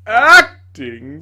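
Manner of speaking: very loud, rising indignant cry; outraged echo. A narrator's reading. Tragedian outraged at being told he acts, loud cry. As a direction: bellow the word back in outrage.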